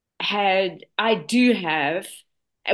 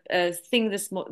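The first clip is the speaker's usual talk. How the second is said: normal talking voice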